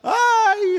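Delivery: high, loud imitated wail. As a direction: imitate a weeping woman's cry of "ay". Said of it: "lloraba y decía".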